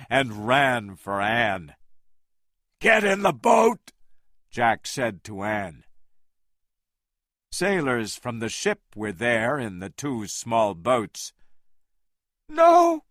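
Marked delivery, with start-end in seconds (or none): 2.8-3.9 high, loud order; urgent shout. A narrator's reading. Jack sending Anne to the boat, a loud urgent order. 12.5-13.0 very high desperate shout. A narrator's reading. Anne refusing to leave Kong, a very high shout. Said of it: "Anne shouted".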